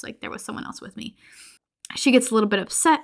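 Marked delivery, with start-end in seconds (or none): 0.0-1.1 hushed, breathless and quick; frightened whisper of a woman who saw her double; scared whisper. Retold or imitated. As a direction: whisper it as if afraid of being overheard. Addie, scared, insisting she saw someone.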